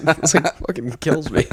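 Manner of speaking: ordinary talk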